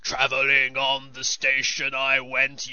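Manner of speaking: slow gruff rock growl; gravelly metal singer. Shown as a demonstration. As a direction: ham up a heavy metal vocalist. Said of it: "sing in a gravelly voice like this".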